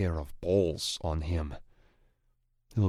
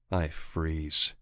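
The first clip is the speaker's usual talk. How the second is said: plain reading voice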